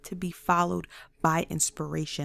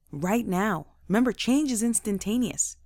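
ordinary talk

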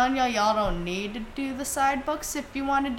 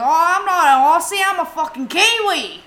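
put-on loud, high New Zealand accent, offended; 8 semitones higher, 8 dB louder than usual. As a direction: play the Kiwi who refuses to be called Aussie. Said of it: "and he goes".

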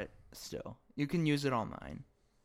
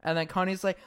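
ordinary delivery

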